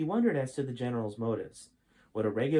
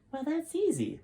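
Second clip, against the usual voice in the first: put-on much higher, cocky kid voice; boy proclaiming. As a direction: toss it off like a smug teen. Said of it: "proclaimed Jason".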